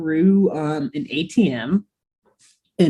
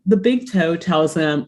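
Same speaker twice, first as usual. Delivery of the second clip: ordinary delivery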